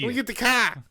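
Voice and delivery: put-on joking Boston accent. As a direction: play along in mock Boston speech.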